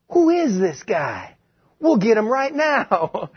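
astonished crowd. Acted. Crowd voiced, acted.

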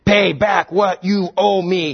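slow, menacing demand; slow threatening creditor; stern demand. Acted. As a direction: grind the words out at the debtor. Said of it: "he demanded".

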